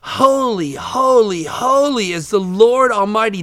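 loud, drawn-out proclamation of praise; calling out. Acted. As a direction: call it out like seraphs to one another. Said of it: "they were calling to one another".

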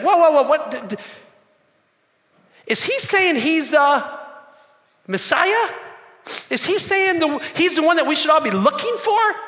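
high, loud disbelief; stunned crowd. Retold or imitated. Preacher voicing the crowd realizing Jesus claims to be Messiah, shocked. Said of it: "starts to register".

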